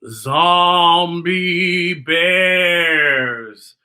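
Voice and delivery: very slow, eerie stretch; spooky drawn-out. A narrator's reading.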